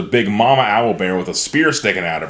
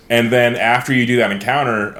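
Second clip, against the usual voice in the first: ordinary talk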